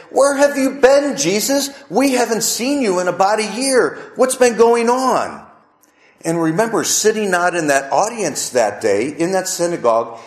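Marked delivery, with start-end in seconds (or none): 0.1-5.4 lightly voiced curious crowd, higher pitch; higher, mildly acted questioning; townspeople questioning. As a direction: imitate townsfolk quizzing someone long absent. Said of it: "as if the people might be saying".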